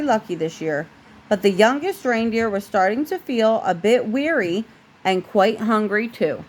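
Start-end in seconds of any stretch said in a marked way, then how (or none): none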